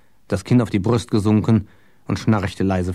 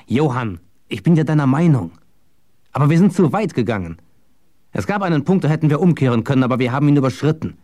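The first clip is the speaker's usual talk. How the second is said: lightly voiced persuader, higher and quickening; urgent Matthias, arguing; 5 semitones higher, faster than usual. A narrator's reading. Matthias telling Johann they went too far, urgent. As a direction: press your case with mild urgency.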